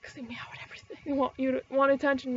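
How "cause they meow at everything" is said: quick whisper under the breath; whispered aside. Real, not acted. Admitting to viewers cats are noisy, whispered aside. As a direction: let it slip as a secret aside.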